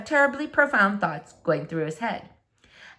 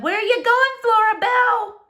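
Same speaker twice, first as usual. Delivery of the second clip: much higher, louder calling out; mother calling Flora from the back door, much higher and louder; 13 semitones higher, 5 dB louder than usual. Acted. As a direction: call after your daughter from the house. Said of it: "She said".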